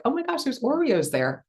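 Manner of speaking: high, delighted surprise; excited gasp. Retold or imitated. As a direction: squeal at finding the cookies.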